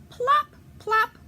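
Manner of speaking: very high, squeaky plop plop; plop sounds. Acted. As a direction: pop each duck into the water. Ducks plopping into river.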